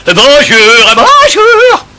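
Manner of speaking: mock birdsong greeting; self-satisfied parrot trill; nightingale voice. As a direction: sing it out like a proud little bird. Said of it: "une véritable voix de rossignol".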